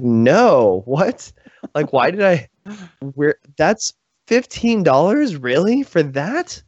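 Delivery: high, slow, disbelieving; outraged disbelief. Retold or imitated. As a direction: stretch it out in mock outrage. Retelling his shock at the movie ending.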